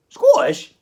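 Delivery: high loud Scottish "Scottish?". Acted. Arguing with a mate over which accent, a loud Scottish query. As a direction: bark "Scottish?" in a high Scots voice. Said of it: "I'll go back to South African".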